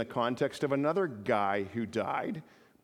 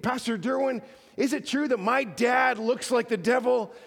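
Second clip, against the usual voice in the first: put-on higher little-girl voice throughout; high, earnest child's question; 9 semitones higher than usual. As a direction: ask it like a curious toddler. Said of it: "little three or four-year-old girl".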